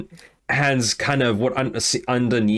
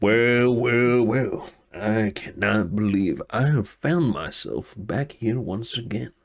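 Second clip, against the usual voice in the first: slow, grand self-satisfied hero; a hint of the showman; lightly voiced swaggering hero; slower than usual. Alador addresses the guild hall, lightly voiced and grand. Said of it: "he stands and goes".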